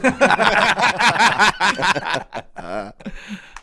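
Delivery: long, loud, high belly laugh; real uproarious laughter. Real, not acted. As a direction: burst out laughing and keep it going. Laughing at the golf story.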